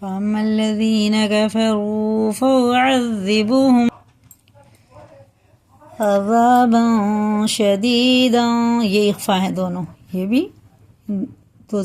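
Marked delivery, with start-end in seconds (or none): 0.0-8.4 slow melodic chanted recitation; Quran recitation. Acted. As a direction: chant the verse slowly with long vowels.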